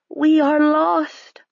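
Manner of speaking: high, very slow lament; high slow hopeless cry; despairing cry. A narrator's reading. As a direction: draw out the despair. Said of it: "Harve cried".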